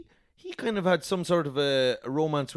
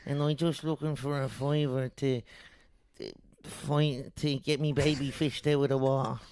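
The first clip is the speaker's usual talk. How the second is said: pleading Dublin beggar; dublin accent; 4 dB quieter than usual. Acted. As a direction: beg for a fiver in Dublin accent. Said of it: "begging in a Dublin accent".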